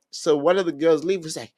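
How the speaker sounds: ordinary talk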